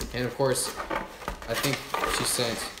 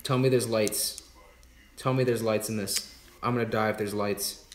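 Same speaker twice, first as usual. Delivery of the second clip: ordinary talk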